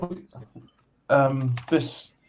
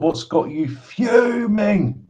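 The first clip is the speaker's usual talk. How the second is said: mock outrage; 8 semitones higher, much slower than usual. Mock concern at friend's anger.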